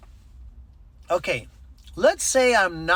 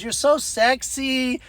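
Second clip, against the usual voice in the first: high, slow mock flirtation; flirting woman, imitated; 8 semitones higher, slower than usual. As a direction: draw it out as a pitched-up come-on. Retold flirtatious advance by the woman.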